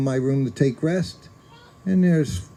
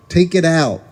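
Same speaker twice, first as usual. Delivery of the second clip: stern order; 4 semitones higher, 7 dB louder than usual. Retold or imitated. Guru angrily ordering the plate out.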